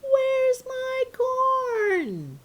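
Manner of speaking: long, drawn-out high call from a distance; mother calling from the house to the garage, drawn out and high; calling from far away. Acted. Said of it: "from far away your mother's voice".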